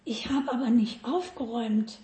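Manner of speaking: put-on a shy little girl confessing; timid whisper-quiet confession. A narrator's reading. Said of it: "sagt sie ganz schüchtern".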